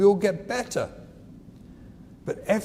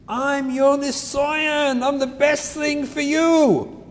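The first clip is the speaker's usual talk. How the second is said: put-on higher, slow, drawn-out kid voice; imitating the kid; 7 semitones higher, slower than usual. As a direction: stretch it out like a proud child. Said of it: "That's what they should really say".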